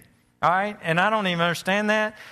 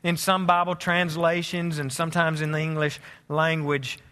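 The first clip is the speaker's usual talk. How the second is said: reflective sermon; 3 semitones lower than usual. Acted. Quiet reflective sermon close.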